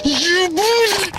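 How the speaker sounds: long drawn-out triumphant cry; excited. Real, not acted. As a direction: stretch it out high in pure triumph. Hunter's triumph after the shot.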